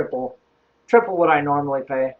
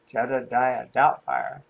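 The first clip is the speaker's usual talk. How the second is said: slow, lower, doubtful echo; imitating a baffled caller, low and slow; puzzled caller; 4 semitones lower, 5 dB quieter, slower than usual. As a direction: repeat the odd name slowly in disbelief. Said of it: "And he's like".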